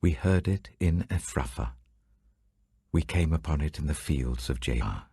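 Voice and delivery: plain reading voice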